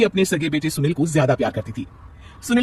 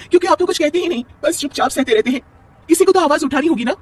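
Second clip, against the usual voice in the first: heated protest; 11 semitones higher than usual. Acted. Wife protests to husband.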